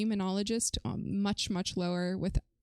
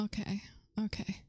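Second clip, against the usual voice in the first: whisper; 6 dB quieter than usual. Real, not acted. Quietly whispering to self.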